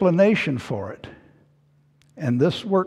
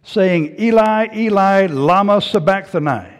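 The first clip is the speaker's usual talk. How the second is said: raised, solemn quoted cry; loud cry; 3 semitones higher, 6 dB louder than usual. Retold or imitated. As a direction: cry it out like scripture read aloud. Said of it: "Jesus cried with a loud voice".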